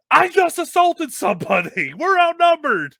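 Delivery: loud, very high panicked yell; alarmed protest. Retold or imitated. Wrestler after throwing a fan, alarmed shouting.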